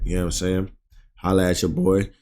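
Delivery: ordinary talk, nothing marked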